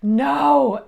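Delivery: a loud, high, stunned "No."; sharp shocked no. Retold or imitated. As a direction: blurt it out in shock. Retold shock at bad news.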